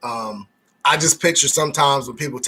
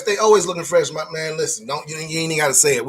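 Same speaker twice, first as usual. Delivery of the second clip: ordinary talk, nothing marked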